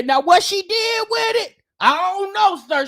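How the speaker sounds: ordinary talk, nothing marked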